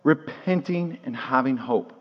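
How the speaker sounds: slow and hushed for weight; hushed repeat. Real, not acted. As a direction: let the key point sink in, softly. Key point repeated for emphasis.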